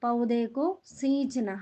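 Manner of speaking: പൗതേക്കോ സീഞ്ചന slowly, leaning on സീഞ്ചന; slow teaching read. Shown as a demonstration. Teacher to pupils, modelling the phrase slowly. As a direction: lay out പൗതേക്കോ സീഞ്ചന word by word. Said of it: "എന്താണ് പറയുന്നത്?".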